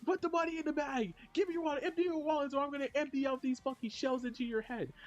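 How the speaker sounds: high, frenzied hold-up yelling; acted robber threat. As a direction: yell threats like a manic robber.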